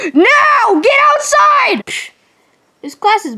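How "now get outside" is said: shouting. Acted. Shouting kids out.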